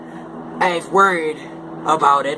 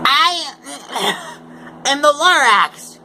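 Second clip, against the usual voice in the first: Lorax voice; 10 semitones higher than usual. Acted. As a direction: squeak it out like the fussy Lorax. Lorax's voice in the story.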